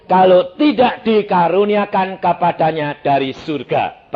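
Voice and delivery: loud, high, declaimed Bible verse; forceful scripture reading. Real, not acted. Preacher reads John the Baptist's words to the congregation, loud proclaiming.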